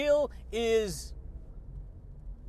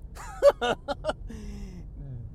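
long run of rapid ha-has; chuckling laugh. Real, not acted. Podcaster amused by own taquito joke, long chuckling laugh. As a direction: keep the chuckle rolling at your own joke.

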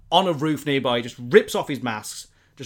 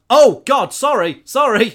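high, loud flustered sorry; panicked apology; 9 semitones higher, 11 dB louder, slower than usual. Retold or imitated. Spider-Man apologizing to Gargan after stabbing him, high panicked sorry. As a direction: blurt it out in sudden alarm.

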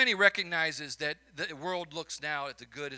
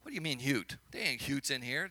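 put-on Chicago accent, low and soft; 5 semitones lower, 5 dB quieter than usual. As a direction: tease the Chicago accent lightly. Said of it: "forgive my Chicago accent with the word youth".

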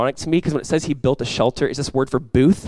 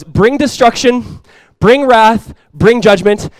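Jonah's demand; 6 semitones higher, 8 dB louder, slower than usual. Retold or imitated. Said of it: "For Jonah, it was".

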